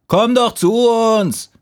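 very high, drawn-out call. A narrator's reading. Fallen leaves calling the last leaf down, high call. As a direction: call up to someone far off, slowly. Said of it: "riefen die anderen".

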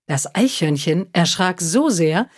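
plain reading voice

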